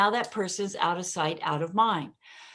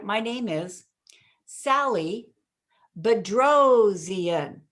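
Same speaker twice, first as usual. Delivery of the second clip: pause, then punch "Bedrosian"; hit the surname; 4 dB louder, slower than usual. Shown as a demonstration. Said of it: "I would hit the Bedrosian".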